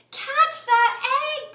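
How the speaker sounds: high, loud frantic yell; Chicken yelling. A narrator's reading. Hen shouts for someone to catch her rolling egg. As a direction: cry out for help. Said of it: "poor Chicken yelled".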